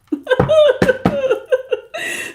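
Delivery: long high-pitched giggle; giggling fit; high laughter. Real, not acted. Laughing at the chat.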